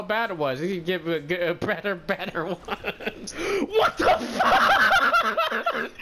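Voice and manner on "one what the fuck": very high, drawn-out shriek through laughter; hysterical laughing shriek. Real, not acted. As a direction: scream it out while cracking up. Cracking up at a game mishap.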